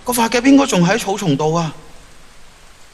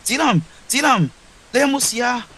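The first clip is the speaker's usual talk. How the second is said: alarmed call; 6 semitones higher than usual. Acted. Finds Zilin collapsed.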